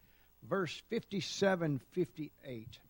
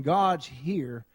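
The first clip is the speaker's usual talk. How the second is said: quoting a preacher; 4 semitones lower, 5 dB louder, much slower than usual. Retold or imitated. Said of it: "Brother Spears was up here saying".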